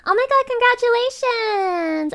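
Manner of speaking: high, delighted congratulations; excited. Real, not acted. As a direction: cheer for a viewer. Greeting a chat viewer.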